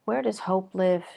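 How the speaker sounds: calm, even meditation voice with long pauses; guided meditation. Real, not acted. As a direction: guide them gently inward, leaving long silences between phrases. Leads guided breathing meditation.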